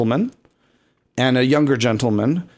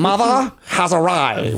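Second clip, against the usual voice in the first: regal, authoritative drag mother; weirdly Jamaican, imperious diva impression; Vivacious; 3 semitones higher than usual. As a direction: announce yourself grandly in a strangled voice. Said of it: "weirdly Jamaican, but also very authoritative".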